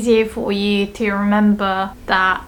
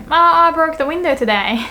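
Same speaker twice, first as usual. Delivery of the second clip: casual admission; 6 semitones higher than usual. Shown as a demonstration. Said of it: "I just wanna say".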